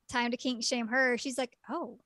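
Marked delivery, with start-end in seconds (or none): 1.7-2.0 hushed confession. Retold or imitated. Girl admits liking his crying.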